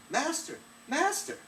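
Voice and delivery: very high, piercing call; the enchanted harp calling its master while stolen; harp crying out. Acted. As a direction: shriek for your master in alarm. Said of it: "it was calling out".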